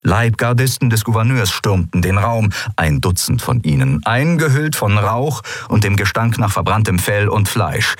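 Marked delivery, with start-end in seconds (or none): none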